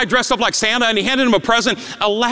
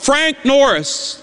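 normal talking voice

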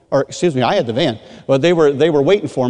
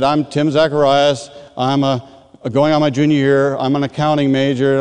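dull, even self-introduction; monotone; 2 semitones lower than usual. Retold or imitated. Student giving his testimony to the group, monotone. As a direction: recite it on one note. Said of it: "real kind of monotone".

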